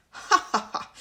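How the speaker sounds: loud breathy ha ha ha; witchy laugh. Acted. Sleeping fairy laughing in her dream, loud cackle. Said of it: "The fairy chuckled".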